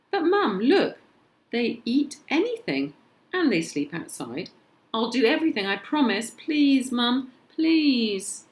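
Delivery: put-on boy begs his mum to keep the dinosaurs; pleading child. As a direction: plead with mum like a kid who wants a pet.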